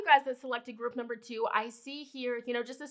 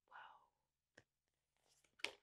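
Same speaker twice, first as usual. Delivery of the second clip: awed whisper; 25 dB quieter than usual. Real, not acted. Awed at the cards.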